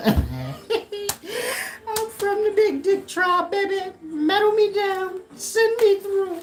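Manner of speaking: very high, slow, laughing brag; hammed-up very high laughing brag; laughing swagger. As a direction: crack up while bragging. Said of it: "I say".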